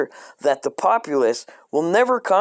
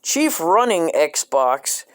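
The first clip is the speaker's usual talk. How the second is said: slow, grand name reading; much slower than usual. Acted.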